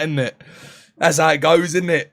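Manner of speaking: normal talking voice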